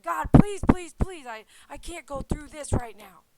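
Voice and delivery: loud, high begging to God; desperate plea. Retold or imitated. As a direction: cry out please in panic. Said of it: "I said".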